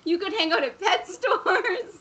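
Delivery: high-pitched, laughing through the words; laughing tease. Real, not acted. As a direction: tease a friend while cracking up. Teasing friend while laughing.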